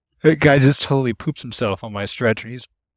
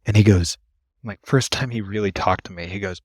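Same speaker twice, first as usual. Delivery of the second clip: ordinary delivery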